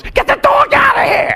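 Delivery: very high, loud hammed-up shriek; mock-calm scream. Wife reacting to the dog in the jacuzzi, hammed-up scream. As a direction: screech it as the wife who is anything but calm. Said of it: "My wife very calmly goes".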